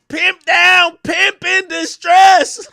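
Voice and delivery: high, loud, stretched siren-like call; mock alarm call. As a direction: announce it like an emergency.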